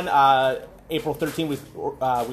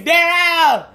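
loud high call across the room; 13 semitones higher, 8 dB louder than usual. Retold or imitated. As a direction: shout the name to get attention. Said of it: "remember I was calling you".

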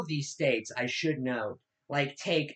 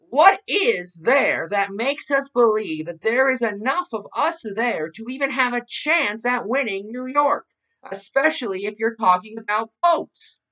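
higher, louder mock radio-host delivery; Rush Limbaugh parody; 6 semitones higher, 4 dB louder than usual. Podcast host quoting a radio host's question, mocking how he speaks. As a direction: send up a pundit's incredulous question. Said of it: "I am making fun of".

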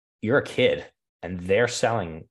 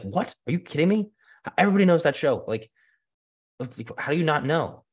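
mock disbelief; 8 semitones higher than usual. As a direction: play the clients' outraged astonishment, pitched up. Clients shocked he doesn't know.

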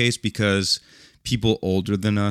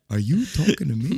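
higher-pitched challenge, half laughing; put-on indignant question; mock offended tough guy; 5 semitones higher than usual. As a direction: play the affronted local, amused. Repeats the offended reply, laughing.

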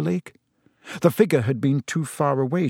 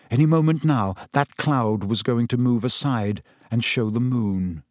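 ordinary delivery, nothing marked

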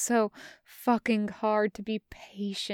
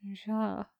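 soft, quiet yes; a hushed murmured ja; 7 dB quieter than usual. Acted. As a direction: breathe it softly after a pause. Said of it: "Bren murmurs after a moment".

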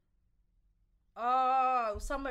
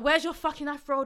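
raised, higher-pitched demand; retold shouting; 4 semitones higher, 6 dB louder than usual. Stranger challenges woman over her hair, retold raised-voice demand. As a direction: imitate an aggressive stranger shouting. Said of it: "you're now shouting at me".

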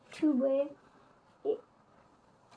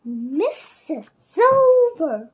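bold call; 2 semitones higher, 10 dB louder, much slower than usual. A narrator's reading. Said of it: "suddenly felt brave".

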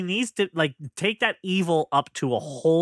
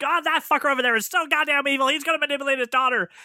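raised, mildly outraged imagined reaction; mock outrage; 7 semitones higher than usual. Imitating a viewer reacting to the villain, mock outrage. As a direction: voice an indignant viewer, pitched up.

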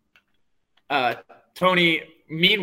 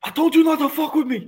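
high, hammed-up mobster snarl; mock Tony losing it, pitched way up; Tony yelling; 13 semitones higher, 3 dB louder than usual. Cohost jumps in voicing Tony, hammed-up high snarl.

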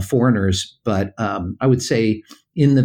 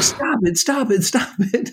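pleading stop; 11 semitones higher than usual. Retold or imitated. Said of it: "and I'm like".

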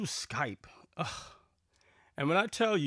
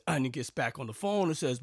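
ordinary talk, nothing marked

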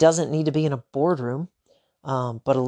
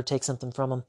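ordinary talk

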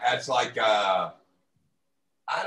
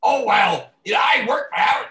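high, loud, rushed pompous boast; hammed-up self-important brag; mock bragging; 5 semitones higher, 5 dB louder than usual. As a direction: puff it up like a washed-up name-dropper. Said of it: "John thinks that he's".

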